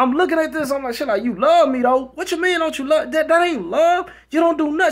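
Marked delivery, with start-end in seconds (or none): 1.2-4.9 high, loud, incredulous comeback; retold indignant retort. Woman challenging her man's claim of love, indignant retort. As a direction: throw it back at him, mock outraged. Retold quarrel, she answers back.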